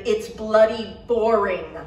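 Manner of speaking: loud, slow thundered insult; thundering. A narrator's reading. Garrett thundering at Michael, loud. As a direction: hammer each word. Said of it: "he thundered".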